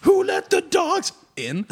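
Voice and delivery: high, laughing, drawn-out singalong; laughing sing-song call. Real, not acted.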